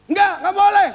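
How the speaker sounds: high, loud refusal in the neighbour's voice; retold yelled objection; imitated angry yell. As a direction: act out an angry neighbour shouting no. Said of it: "waktu dia teriak-teriak, waktu dia marah-marah".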